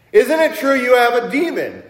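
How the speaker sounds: high, loud accusing question; mock accusation. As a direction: corner them with a leading question. Acting out the accusers' loaded question.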